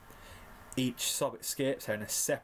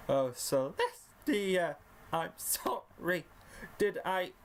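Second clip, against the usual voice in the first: high, slow apology with cracking squeaks; squeaky, faltering pony voice; 6 semitones higher, much slower than usual. A narrator's reading. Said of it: "squeaky voice crack".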